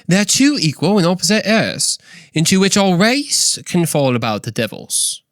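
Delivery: high, theatrical British accent; C S Lewis impersonation. Shown as a demonstration. Podcaster retries the quote as its author, British accent. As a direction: read it out in a hammed-up British accent. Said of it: "with my best C S Lewis impersonation".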